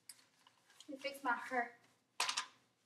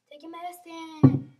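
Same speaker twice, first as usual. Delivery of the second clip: much louder, slow insistent order; louder repeat; 13 dB louder, slower than usual. Shown as a demonstration. Nurse imitating how they say it, loud slow insistence. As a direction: bark the order slowly. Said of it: "They speak like that".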